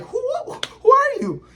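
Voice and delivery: sudden high, startled question; shocked. Retold or imitated. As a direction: jump up in pitch, stunned. Code shocked at the counter.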